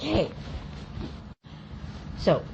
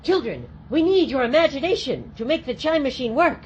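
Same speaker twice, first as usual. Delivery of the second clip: put-on kids party magician; 6 semitones higher than usual. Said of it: "And you're like".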